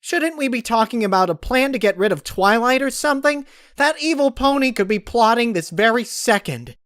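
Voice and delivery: high, loud exasperated shout; frustrated outburst. Acted. Pinkie venting at Applejack and Big Mac, loud and high. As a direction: let it all burst out. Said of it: "Pinkie Pie was breathing heavily".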